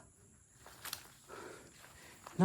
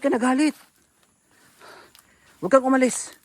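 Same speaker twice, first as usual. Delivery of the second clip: higher, loud pleading calls; calling out; 3 semitones higher, 6 dB louder than usual. Real, not acted. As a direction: call after her to stay. Calling after woman.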